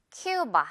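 "큐바" said KYOO-ba for repeating; model pronunciation. Shown as a demonstration. Teacher has learners listen and repeat, English-style "큐바" as KYOO-ba. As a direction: say "큐바" clearly with "kyoo" first. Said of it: "듣고 따라해 주세요".

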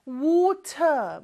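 British "water" with a crisp T and no final R; British pronunciation. Shown as a demonstration. Teacher showing how Britain says water, British accent. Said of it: "영국에서는 발음을 하기는 해요".

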